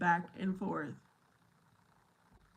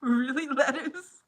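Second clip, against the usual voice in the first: mock disbelief; 6 semitones higher, 8 dB louder, slower than usual. Real, not acted. Reacting to a chat question.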